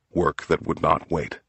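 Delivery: usual speaking voice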